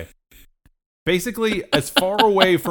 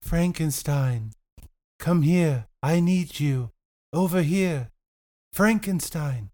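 a hint of a woman calling, a bit higher; lightly voiced luring woman; 5 semitones higher, slower than usual. Shown as a demonstration. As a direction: coax him over with a lightly put-on woman's voice. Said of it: "give us a little bit of a soundbite".

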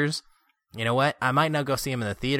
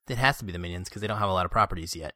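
ordinary delivery

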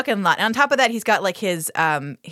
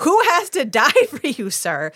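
incredulous retort; 6 semitones higher than usual. Retold or imitated. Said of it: "I'm like".

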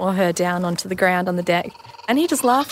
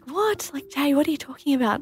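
mock disbelief; 7 semitones higher, 4 dB quieter than usual. Husband's disbelieving reply, retold.

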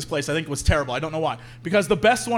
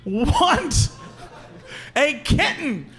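high-pitched mock tantrum wail; hammed-up little girl losing it; child's tantrum; 7 semitones higher, much slower than usual. Girl losing her mind at coffee counter, mock tantrum. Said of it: "And the girl loses her mind".

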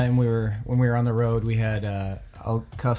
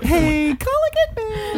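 very high sing-song call. Retold or imitated. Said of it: "hear somebody go".

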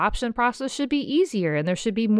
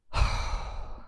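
heavy sigh; 5 dB quieter than usual. Real, not acted. Overwhelmed after emotional rant.

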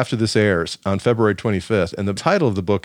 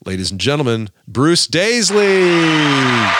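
drawn-out, louder higher announcer call of Bruce Daisley; announcer intro; 5 semitones higher, 4 dB louder, slower than usual. Acted. Podcast host introducing his guest Bruce Daisley, slow booming announcer call. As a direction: boom the name out slowly like a ring announcer.

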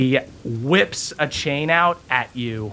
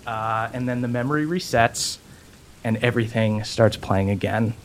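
normal talking voice

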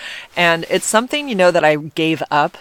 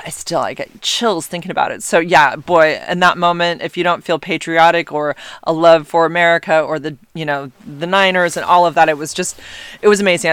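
ordinary delivery

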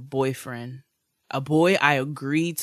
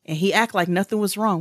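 normal talking voice

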